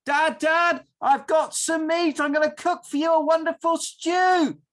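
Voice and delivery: high, loud proud shout; Esau shouting. Acted. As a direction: call out triumphantly from the doorway. Esau calling back from hunting.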